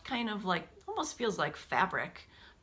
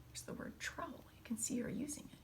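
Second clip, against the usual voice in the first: whispered aside; 2 semitones higher, 14 dB quieter, faster than usual. Real, not acted. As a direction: softly point it out in a whisper. Whispered aside during reading.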